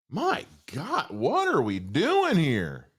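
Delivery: high start sinking into slow despair; drawn-out, deflating frustration; exasperated. Real, not acted. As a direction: stretch the words out in disbelief. Exasperated rant about trailer.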